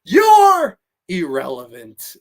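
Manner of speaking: loud, drawn-out wrestler catchphrase; Bill Goldberg. Acted. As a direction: bellow it like a wrestler's taunt. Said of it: "favorite movie of Bill Goldberg".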